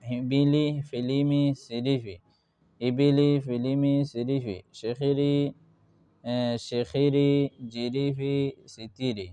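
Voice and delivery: whole blended words like "ফিলিমি", each said apart with drawn syllables; reading demo. Shown as a demonstration. Teacher showing pupils the reading step, measured word-by-word recitation. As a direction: read "শেখিরি" as one stretched word, without spelling it out. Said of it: "এইরকম আবার reading পড়িবা".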